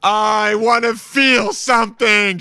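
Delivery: hammed-up tearful wail; mock weeping. Dan crying in the shower, hammed-up sobbing plea. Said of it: "just weeping in the shower".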